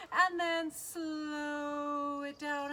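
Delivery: long stretched slow-motion words; slowing right down. Pretend. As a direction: draw SLOW way out for the kids. Voice mimics slowing wings.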